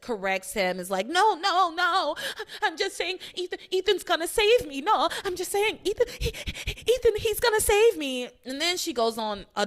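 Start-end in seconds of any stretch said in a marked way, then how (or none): 1.1-8.3 insistent denial. Retold or imitated. Teresa insists, retold.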